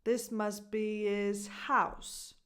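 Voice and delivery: slow model, "his" as "iz"; dropped H in HIS. Shown as a demonstration. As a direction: draw out "be-iz", then strong H on "house". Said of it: "No H in his".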